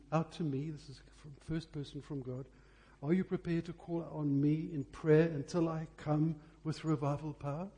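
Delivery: normal talking voice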